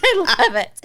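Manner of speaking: gushing through laughter; laughing delight. Real, not acted. Gushing to co-host about the taco place, laughing.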